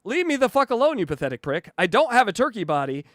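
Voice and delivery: ordinary talk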